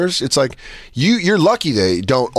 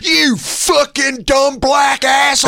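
high, drawn-out mock shout; hammed-up furious heckle, slowly stretched out; heckler's abusive outburst; 11 semitones higher, slower than usual. Fan jeering a player from his seat, high mock-furious shouting. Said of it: "he goes back and goes".

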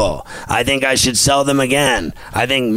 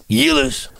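taunting catchphrase. Retold or imitated. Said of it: "favorite things to say".

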